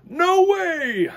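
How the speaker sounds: high, loud farmer saying no; Farmer Brown refusing. Acted. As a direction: plant your feet, flatly refuse.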